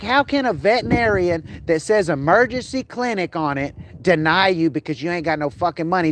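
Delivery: ordinary delivery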